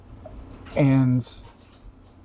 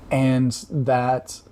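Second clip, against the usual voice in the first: tearing up; slower than usual. Real, not acted. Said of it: "I don't know why I'm tearing up".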